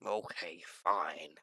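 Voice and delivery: low, quiet, sulky concession; deflated little grumble; dejected. Acted. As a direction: give in softly with a pout. Scientist sulks after being refused.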